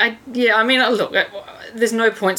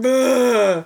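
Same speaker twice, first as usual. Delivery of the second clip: a scornful raised "Bleh", mocking the booing men; disgusted bleh; 6 semitones higher than usual. Imitating a disgruntled male fan's dismissive grunt. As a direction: spit out the "Bleh" in contempt. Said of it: "I can't even imagine just going".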